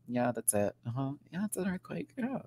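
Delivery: soft, offhand imitation of casual reassurance; Michelle, sweet and matter-of-fact. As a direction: murmur it lightly as if it's nothing. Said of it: "Michelle was so sweet, though. She was very like".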